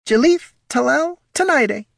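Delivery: very high, lofty kobold voice; affected high-pitched announcement; airy self-introduction. Acted. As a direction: present the name grandly in a tiny voice. Airily announces his elven name.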